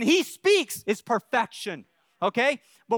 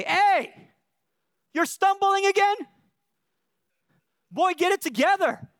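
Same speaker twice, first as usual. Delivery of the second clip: harsh father; 5 semitones higher than usual. Retold or imitated. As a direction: bark at the stumbling kid like a tough father. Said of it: "I didn't say".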